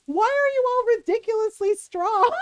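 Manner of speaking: imitating a boy yelling in pain; mock yell. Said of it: "he starts yelling".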